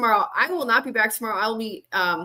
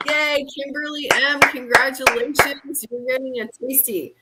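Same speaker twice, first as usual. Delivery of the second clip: excited announcement; 5 semitones higher, 3 dB louder than usual. Real, not acted. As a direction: celebrate the winner out loud. Announcing second giveaway winner.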